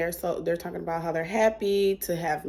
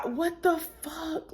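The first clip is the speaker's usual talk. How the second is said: incredulous; 8 semitones higher, 8 dB quieter, slower than usual. Retold or imitated. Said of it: "And I'm just like".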